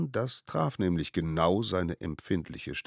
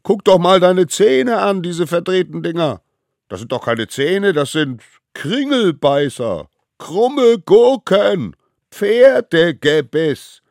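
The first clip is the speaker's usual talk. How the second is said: loud, high name-calling; gleefully nasty kid gang; jeering taunts; 12 semitones higher, 14 dB louder, slower than usual. Acted. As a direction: pile on insults, stretching the last ones. Gang hurls insults at disguised child.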